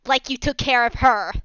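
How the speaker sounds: toned-down mock shout; milder repeated shout. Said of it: "He shouts".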